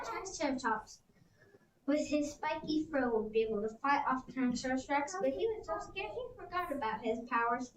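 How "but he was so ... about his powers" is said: ordinary delivery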